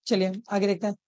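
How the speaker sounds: usual speaking voice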